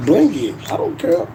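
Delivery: usual speaking voice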